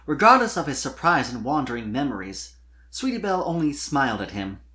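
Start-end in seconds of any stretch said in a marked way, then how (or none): none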